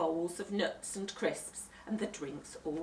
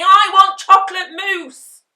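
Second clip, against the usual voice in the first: high, loud defiant scream; 13 semitones higher, 24 dB louder than usual. A narrator's reading. Henry defying Mum, loud scream.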